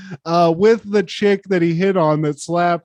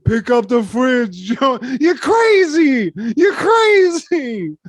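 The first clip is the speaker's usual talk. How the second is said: high, slow exclamation building up; mock boxing brothers; 6 semitones higher, 3 dB louder, slower than usual. Co-host playing the sketch brothers, high stretched yelling. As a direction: rise into a drawn-out cry.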